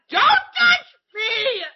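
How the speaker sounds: high, drawn-out mock shriek; imitating a frightened scream; panicked retold scream. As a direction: shriek it slowly in comic terror. Retells panic as bat flies.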